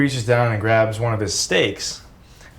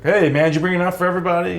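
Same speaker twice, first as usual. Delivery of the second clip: fast, higher teasing jab at a friend; mocking Rudy; 7 semitones higher, much faster than usual. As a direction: rattle it off, higher and cheeky. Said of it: "And Rudy was like".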